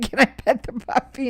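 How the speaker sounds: put-on little girl baby voice. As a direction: ask like a small child. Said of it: "Like she's a little girl going".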